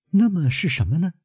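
put-on high-pitched girl's question; young woman wondering, voice high; puzzled granddaughter. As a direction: ask in a lifted, questioning voice.

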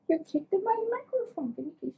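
very high, soft cooing. Real, not acted. Scolding pet in baby talk.

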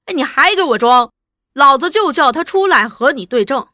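loud, overbearing anger; blustering threat. Acted. Interrogator threatens to bring out the witness, loud and blustering. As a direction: bluster loudly at a stubborn prisoner. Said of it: "朱大同还是声势汹汹".

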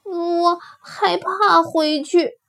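high, slow, reluctant little voice; frightened rabbit. Acted. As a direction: admit fear timidly, dragging the words. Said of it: "胆小兔说". (胆小害怕地慢慢说)

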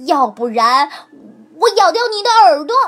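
fast, loud threat; threatening. A narrator's reading. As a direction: bite the words out. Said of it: "骨头叫道". (骨头快而响亮地威胁狐狸)

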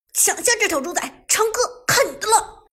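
high, loud battle cry to a teammate; loud roar. A narrator's reading. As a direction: roar the order out to your teammate. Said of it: "Tiger大声地吼道".

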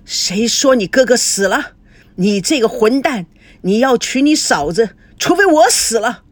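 furious scolding that climbs at the end; outraged, emphatic rebuke; angry father. Acted. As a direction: scold and forbid, peaking on the last phrase. Father threatens son with a stick.

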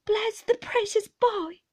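high, loud exclamation of concern; nurse's fussing outcry; cried out. A narrator's reading. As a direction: cry out in alarm over the child. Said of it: "cried Peggotty".

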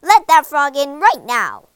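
very loud, angry command; furious father. A narrator's reading. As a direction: order your daughter at full volume. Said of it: "said her father furiously".

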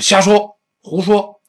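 loud, high, short retort; angry dismissal. Acted. Reacting to an infuriating remark, angry retort. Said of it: "老外很生气".

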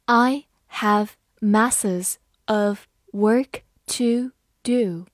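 slow, word-by-word reading; unhurried teacher's reading with clear gaps; slow model reading. Shown as a demonstration. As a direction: spell out the sentence one word at a time. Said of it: "慢读一遍".

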